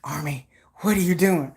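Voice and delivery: low, soft, drowsy voice. Retold or imitated. Vlogger imitating the idol asking fans what they do. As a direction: murmur it like he just woke on stream. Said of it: "my [name] impersonation".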